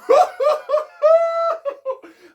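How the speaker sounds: loud, high hooting laugh; animals laughing. A narrator's reading. Animals joining in with Chipmunk, laughing loudly. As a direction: hoot with laughter like a crowd of animals. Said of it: "they were laughing too".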